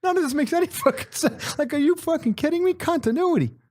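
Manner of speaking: can't believe what he's hearing; hammed-up exasperated disbelief; incredulous. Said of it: "We're like".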